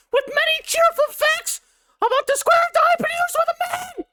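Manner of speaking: high, loud croaking cartoon toad. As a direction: belt it out as a silly shouting toad. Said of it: "the Toad voice".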